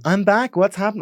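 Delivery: high, perky homecoming announcement; excited returnee. Retold or imitated. Returning emigrant greeting friends, high excited voice.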